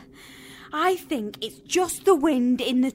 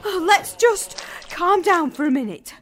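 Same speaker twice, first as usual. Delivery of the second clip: panting, raised voice; out of breath; 2 semitones higher than usual. Acted. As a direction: gasp it out after running upstairs. Catching breath after running upstairs.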